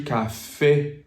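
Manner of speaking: CAFÉ ending on a steady É, no glide; French "café", last vowel stopped short; pure French vowel. Shown as a demonstration. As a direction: say "café" and stop before any EE. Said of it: "You stop there".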